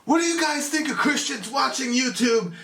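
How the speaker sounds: high, put-on stage-announcer call. Singer asking the crowd about Christians, mock stage voice. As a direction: imitate a metal frontman addressing the crowd.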